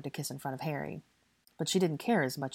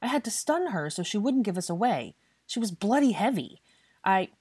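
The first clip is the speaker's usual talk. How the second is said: higher, exasperated justification; emphatic, aggrieved complaint; heated self-defence; 5 semitones higher, 4 dB louder than usual. A narrator's reading. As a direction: justify it indignantly. Draco defends himself in quarrel.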